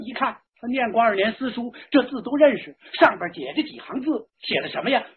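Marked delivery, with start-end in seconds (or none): none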